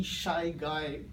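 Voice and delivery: ordinary talk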